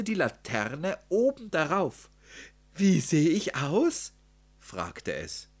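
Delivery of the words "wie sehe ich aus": high, loud, eager piglet; excited little storybook character; eager question. A narrator's reading. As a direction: ask it proudly, pitched well up. Piglet eagerly shows off costume.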